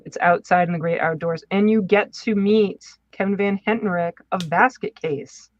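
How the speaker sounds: ordinary delivery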